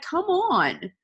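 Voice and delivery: high, pleading "come on"; exasperated urging. Acted. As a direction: urge them as if shaking them. Acts shaking listeners into action.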